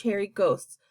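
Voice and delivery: plain reading voice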